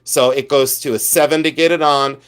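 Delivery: usual speaking voice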